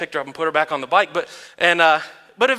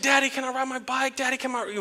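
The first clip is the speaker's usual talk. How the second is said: high, soft imitation of a pleading little girl; mock pestering in a small raised voice; begging child; 5 semitones higher, 7 dB quieter than usual. Daughter begging dad to ride her bike, imitated pleading. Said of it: "she was begging me".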